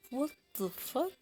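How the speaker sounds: hushed, rising startled swear; a quiet shocked reaction; startled what the fuck. Retold or imitated. Retelling the pans crashing, a quiet startled swear.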